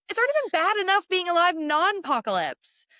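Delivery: high, loud mock despair; hammed-up complaint about being alive; dramatic lament. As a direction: wail it like a drama queen. Exaggerated lament.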